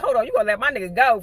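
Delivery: fast, loud and high, jumping to his defense; fired-up confrontation. Retold or imitated. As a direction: step in hot, voice up, talking fast. Tiff ready to fight for her man.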